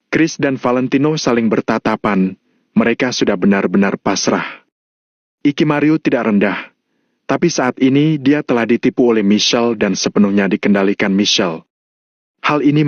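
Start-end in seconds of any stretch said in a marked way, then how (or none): none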